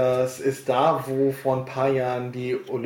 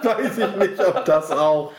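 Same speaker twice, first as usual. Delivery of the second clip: high giggling words; laughing too hard to finish; 4 semitones higher, 5 dB louder than usual. Real, not acted. Laughing at the bear joke.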